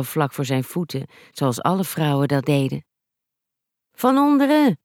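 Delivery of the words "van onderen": shouted warning. A narrator's reading. Blake calls from afar as a tree falls, shouted warning. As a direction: call out a warning to someone far off. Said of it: "schreeuwde Blake in de verte".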